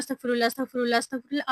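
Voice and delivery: अस्तगफिरुल्लाह rattled off fast, words run together; rushed recitation. Shown as a demonstration. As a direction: gabble the अस्तगफिरुल्लाह like a hurried chant. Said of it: "वो ना बहुत speed में पढ़ रहे होते हैं". (तेज़ी से, जल्दी-जल्दी अस्तगफिरुल्लाह पढ़ना)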